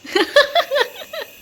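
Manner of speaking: high-pitched burst of laughter; short, high giggling fit. Real, not acted. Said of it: "क्यों हंस रहे हो?".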